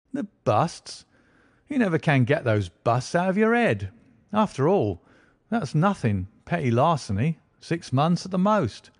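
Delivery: a self-satisfied detective gloating a little; smug inspector. Acted. Lestrade dismisses the busts.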